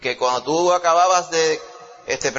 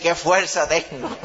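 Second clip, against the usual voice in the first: dicho entre risas, despacio; burlándose de su propia fuerza; riendo; slower than usual. Retold or imitated. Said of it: "yo iba a decir". (con risa y lentitud)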